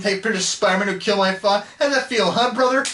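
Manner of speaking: hammed-up confrontational impression; Toby. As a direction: build to a high, goading jab. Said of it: "Toby's like".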